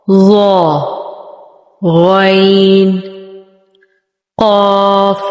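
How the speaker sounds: each letter drawn out full and heavy; heavy letters read out. Shown as a demonstration. Teacher reciting the heavy letters, drawn out and full. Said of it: "یہ سات موٹے پڑھے جانے والے حروف کون کون سے ہیں".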